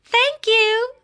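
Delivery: high, light woman's thanks; a woman thanking him brightly; lightly voiced woman. A woman thanks Mister Good in a high, light voice. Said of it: "said a woman".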